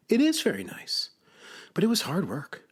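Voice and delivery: high-pitched storybook character; Frog. A narrator's reading. Frog answers Toad about his garden, high character voice. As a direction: play a friendly creature in a high voice.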